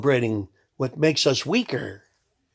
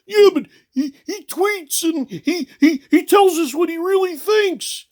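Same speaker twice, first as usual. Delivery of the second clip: much higher, louder whiny mock voter voice; mocking dumb voter; 4 dB louder than usual. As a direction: squeak it out like a gushing fan. Mocking voter defending Trump.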